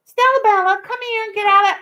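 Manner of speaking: high, loud singsong dog call. Real, not acted. Owner calling her dog out of the birdseed, high loud singsong.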